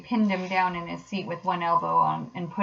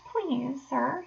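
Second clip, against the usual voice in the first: high, soft wheedling; small, high pleading voice; meek pleading; 7 semitones higher, 5 dB quieter than usual. A narrator's reading. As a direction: plead softly as a meek washerwoman. Disguised Toad pleads with the driver.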